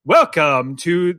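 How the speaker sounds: slow, drawn-out mock intro; imitating Dad. Son mocking Dad's flat intro, slow and drawn out. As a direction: drag the words out like Dad did. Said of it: "he would say".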